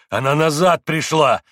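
angry insistence. A narrator's reading. Mitya insists.

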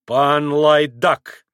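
slow, loud, contemptuous grumble; grumbled insult. A narrator's reading. Tall Pole insults Maximov, grumbling slowly. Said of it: "проворчал вдруг высокий пан".

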